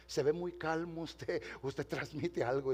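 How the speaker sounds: slow, soft quiet admiration; hushed admiring. Retold or imitated. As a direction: say it gently, in awe. Woman quoted, slow and hushed. (despacio y en voz baja, con admiración)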